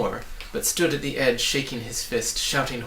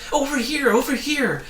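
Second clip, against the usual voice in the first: much higher and louder call; 13 semitones higher, 6 dB louder than usual. A narrator's reading. Hidden voice guiding the travellers, calling out. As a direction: call them toward you. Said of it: "advised the voice".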